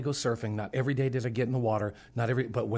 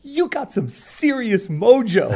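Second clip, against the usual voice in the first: high, loud, slow and laughing; excited praise; 11 semitones higher, 11 dB louder, slower than usual. Retold or imitated. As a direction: marvel at him, laughing. Excited man praising the preacher.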